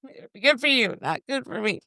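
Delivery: high, sugary reassurance to a nervous stranger; mock soothing. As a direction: pat her on the head with your voice, sweetly fake.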